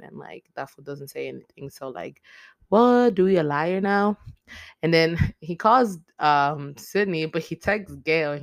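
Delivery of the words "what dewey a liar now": loud, high-pitched incredulous outburst; mock shock. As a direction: call out the lie in exaggerated disbelief. Mock shock at Dewey's lie.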